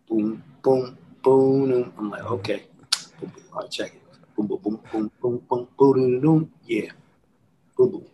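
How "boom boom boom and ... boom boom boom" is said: beat mouthing. Retold or imitated.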